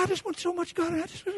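soft, high tearful imitation; mock weepy voice; imitated crying. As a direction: mimic her crying out her heart, quietly. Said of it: "She just crying out her heart".

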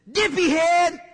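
loud, high angry yell; angry outburst. Retold or imitated. Backseat driver yelling at a car that pulled out, angry outburst. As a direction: blurt it out as a furious shout. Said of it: "Was the word spoken in anger?".

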